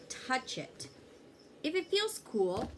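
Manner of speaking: ordinary delivery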